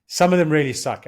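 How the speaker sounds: emphatic restatement. Real, not acted. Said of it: "To bring in a, a crude statement".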